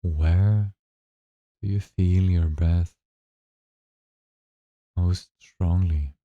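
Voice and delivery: slow, calm meditation guide voice; guided meditation. Acted. Guide leading listeners through their first breathing meditation, slow and calm. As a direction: ease them into the breath, unhurried and gentle.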